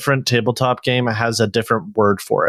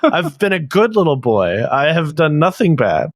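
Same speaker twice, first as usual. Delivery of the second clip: mock-wounded plea; 6 semitones higher than usual. Co-host begging to be spared math, mock-wounded. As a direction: plead innocence like a wronged child.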